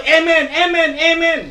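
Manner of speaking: imitating the congregation shouting amen; mock eager congregation. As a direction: parody the churchgoers' easy amens.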